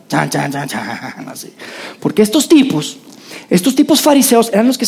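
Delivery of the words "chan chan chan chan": low ominous chan chan chan; dramatic music hum. Shown as a demonstration. Preacher imitating suspense music for the Pharisees. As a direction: hum the villains' entrance theme, deep. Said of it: "empieza la, la música".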